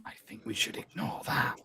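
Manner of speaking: low, hushed in-character whisper; whispered aside. Acted. Player whispering to John's character in the hallway, hushed. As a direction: whisper it privately to a teammate. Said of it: "I'm gonna whisper to John".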